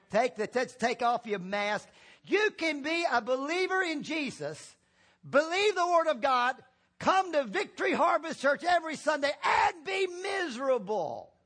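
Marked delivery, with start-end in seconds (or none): 2.3-11.3 impassioned preaching. Real, not acted. Preacher urging church to take off the mask, impassioned preaching. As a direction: preach it with rising force to the last word.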